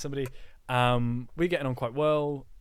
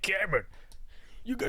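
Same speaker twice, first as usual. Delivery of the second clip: higher, lightly put-on parent voice; parent impression; 5 semitones higher than usual. Impersonating friend's parent calling him, pitched up. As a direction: call out like his parent. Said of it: "done the impressions of my parents".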